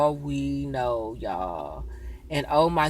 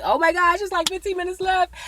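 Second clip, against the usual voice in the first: surprised gasp; 12 semitones higher than usual. Retold or imitated. Shocked at time stamp.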